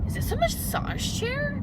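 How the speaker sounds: high, slow, incredulous question; drawn-out high disbelief at a massaging chair; baffled question. Retold or imitated. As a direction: ask it like you can't believe the chair is moving. Confused at massaging dentist chair.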